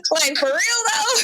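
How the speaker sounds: high, laughing half shout; laughing disbelief. Real, not acted.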